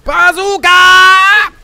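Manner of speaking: long, high, drawn-out battle cry; yelling. Real, not acted. Player announcing the shot, yelling. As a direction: yell it loud, stretching the vowels. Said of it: "the reason I'm yelling it".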